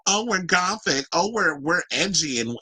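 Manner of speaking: high, mock-posing fan voice; mocking poser. Vlogger mocking hipster queens who act gothic, mocking poser.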